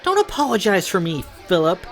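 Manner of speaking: high, loud, indignant; angry snap. Acted. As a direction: cut her brother off furiously. Sarah snaps at her brother.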